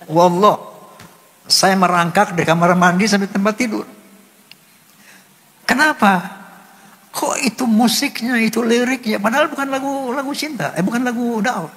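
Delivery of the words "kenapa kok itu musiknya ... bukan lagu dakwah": very high, choked-up questioning; tearful. Real, not acted. Singer baffled that a love song moved him, tearful. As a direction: ask it through rising tears, high and thin on breath. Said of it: "saya nangis gerung-gerungan".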